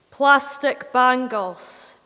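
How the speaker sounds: usual speaking voice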